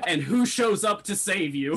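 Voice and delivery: high, loud, laughing through the words; real laughing excitement. Real, not acted. Player imagining an unlikely rescue, laughing excitedly. Joking prediction amid table laughter.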